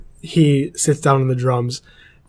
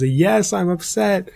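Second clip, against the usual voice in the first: half-hearted retold scream in a raised pitch; mock scream; 6 semitones higher, slower than usual. Humiliated student answers his teacher, a mild mock scream. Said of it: "he screams at the top of his lungs".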